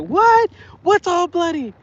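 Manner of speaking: very high imitation of a startled mom; mock shock. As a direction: shriek the question like an alarmed mother. Voices the alarmed mom.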